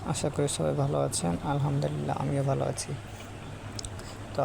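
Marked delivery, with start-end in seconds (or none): none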